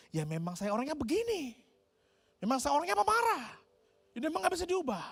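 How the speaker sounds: rising high, a mildly indignant self-excuse; defensive hothead. Retold or imitated. Preacher voicing a quick-tempered man defending his temper, mildly indignant. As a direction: play the stubborn man who says he can't change, lightly.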